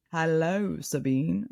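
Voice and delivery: a hint of the audiobook accent, drawn out; Baxian's accent. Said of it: "I really wanna do it in his accent".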